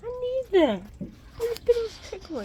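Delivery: high, loud, fast complaint; high loud rant. Real, not acted. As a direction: spit it out in a rush. Ranting about lost money.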